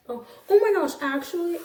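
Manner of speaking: loud, high burst of remembering; sudden realization. Real, not acted. Vlogger suddenly remembering news to tell viewers, startled and loud. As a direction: blurt it out as it hits you.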